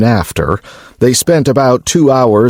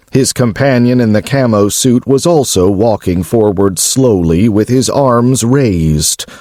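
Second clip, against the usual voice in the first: plain reading voice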